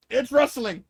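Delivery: loud, high fib; dad hollering a cover story; mock shouted excuse. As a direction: yell a lame excuse.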